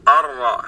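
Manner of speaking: الراء with a brief, light trill of the r. Shown as a demonstration. Teacher showing the correct ra for the listeners. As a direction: roll the r in الراء just once or twice. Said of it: "فنسمعها هكذا".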